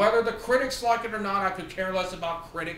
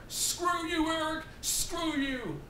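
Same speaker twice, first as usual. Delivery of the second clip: mock high sneer; 10 semitones higher, 4 dB quieter than usual. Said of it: "he told Eric".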